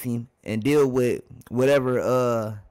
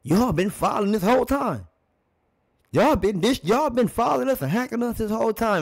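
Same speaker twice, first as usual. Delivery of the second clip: high-pitched mock complaint; imitating irate player; 10 semitones higher than usual. YouTuber guesses what Kareem said on court, mock complaint. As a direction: protest as an aggrieved ballplayer, voice pitched up. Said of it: "kinda looked like to me that he said".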